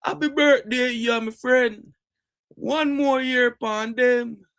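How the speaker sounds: high, slow Jamaican patois greeting; Jamaican accent. Retold or imitated. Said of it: "You know what they say that Jamaica?".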